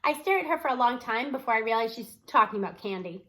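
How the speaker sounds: usual speaking voice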